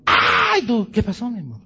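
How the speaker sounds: a high, loud mock shriek of fright at the movie; hammed-up movie-date scream. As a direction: squeal like a girlfriend startled by Dracula. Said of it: "y aquella grita".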